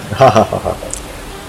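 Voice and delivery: acted chuckle trailing away; captain's laugh. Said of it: "He kinda laughs and says".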